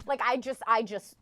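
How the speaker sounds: usual speaking voice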